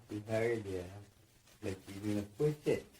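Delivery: ordinary delivery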